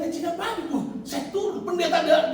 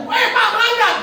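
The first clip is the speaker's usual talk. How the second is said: calling out; 13 semitones higher, 6 dB louder than usual. Retold or imitated. Scolding a traffic violator.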